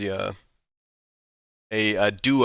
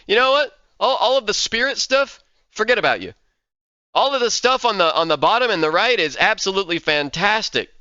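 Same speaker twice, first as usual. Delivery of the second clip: excited; 7 semitones higher, 3 dB louder than usual. Real, not acted. Gushing over the ascendancy.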